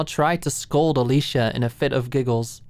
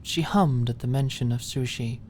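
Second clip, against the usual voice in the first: ordinary delivery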